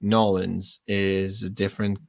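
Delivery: low, slow New Orleans drawl; New Orleans accent. Shown as a demonstration. Telling co-host New Orleans differs, low New Orleans drawl.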